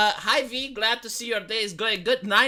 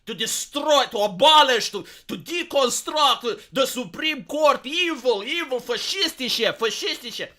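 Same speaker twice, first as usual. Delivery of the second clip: mock meltdown rant; 3 semitones higher, 3 dB louder than usual. Streamer mocks Olbermann's Supreme Court meltdown for chat, hammed-up rant. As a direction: ham up a furious political rant, dragging out deconstruct. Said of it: "like, this meltdown".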